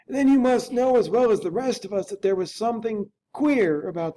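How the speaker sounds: put-on a frightened old butler unsure of what he saw; Poole the butler. Butler tells the lawyer about the masked figure, high and fretful. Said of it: "said Mister Utterson".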